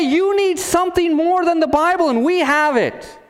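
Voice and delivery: high, insistent mock yell; obnoxious shouting. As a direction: hawk it like a pushy false prophet. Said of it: "Their voice is obvious and obnoxious".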